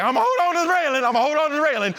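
high, fast, laughing panic; scared. Retold or imitated. Preacher retelling clinging to the Grand Canyon railing in a high, laughing panic. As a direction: clutch the railing in mock terror, laughing.

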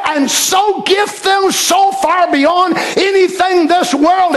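high, slow and shouted sermon; preaching at full force. Acted. Preacher rousing the congregation, shouting slowly. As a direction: preach it up, drawing each word out.

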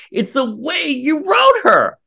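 high, slow, each word hammered in fury; It's the way you wrote her, said in real anger. Real, not acted. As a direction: spell out the blame slowly, furious. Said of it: "I'm sorry, I'm so angry".

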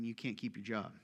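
low, soft menacing line; quiet threat. Retold or imitated. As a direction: say it like a quiet ultimatum. Voicing a threat.

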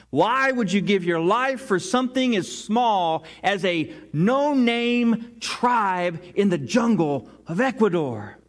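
ordinary talk, nothing marked

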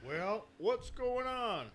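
high, slow put-on country voice; Jerry Moss's weird voice. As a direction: drag it out high like an odd farmer. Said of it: "It's a really weird voice".

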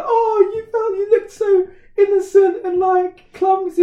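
very high, loud, squeaky words through laughter; laughing to tears. Retold or imitated. Friend admitting why she laughed, crying with laughter. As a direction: gasp it out while crying with laughter.

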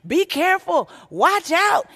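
a big sister calling her brother back from the rain; mock yell. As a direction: call out a warning across the yard. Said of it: "she was in the garage yelling at my son".

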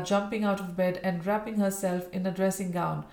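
ordinary delivery

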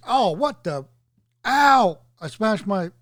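ow jumps high and loud in sudden pain; mock pained outburst. Man acting out hurting himself, a sudden yelp of pain. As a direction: play stubbing something and yelping.

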